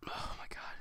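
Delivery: hushed, whispered aside to himself; whispered dismay. Real, not acted. Teller recalling his scary night, dismayed whisper. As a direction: whisper it under the breath.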